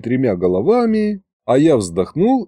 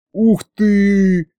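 a high, bright-eyed "ух ты"; happy surprise at the news; delighted surprise; 8 semitones higher than usual. Acted. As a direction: gasp it out, pleasantly surprised. Fedya hears about the baby.

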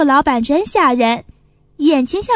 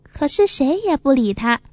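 usual speaking voice

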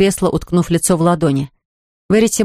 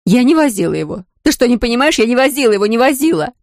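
desperate crying out; 5 semitones higher than usual. Acted. Sister's desperate confession.

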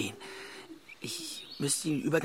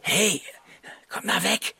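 urgent call; 7 semitones higher, 7 dB louder, slower than usual. Acted. Calling friend away from danger.

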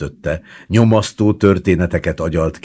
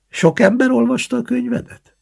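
lightly voiced old woman, much higher; a curious grandmother, pitched up high; 4 dB louder than usual. A narrator's reading. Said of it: "grandmother questions grandson".

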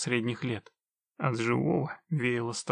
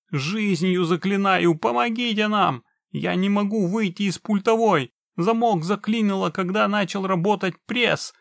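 high, loud, desperate begging; panicked yelling; 7 semitones higher, 5 dB louder than usual. A narrator's reading. Man begs witcher to save wife and children, desperate yelling. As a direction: beg loudly for their lives. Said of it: "не прекращал орать человек".

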